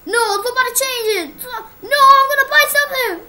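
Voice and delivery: loud, high pleading cries; real despair over lost money; desperate wail. Real, not acted. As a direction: wail for someone to stop it. Desperate cry.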